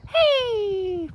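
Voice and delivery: very high, loud squeaky hey; owl puppet voice. Pretend. Owl puppet greets in Swedish, high and loud. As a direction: shout it as the tiny owl. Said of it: "Gonna do your Swedish bit now".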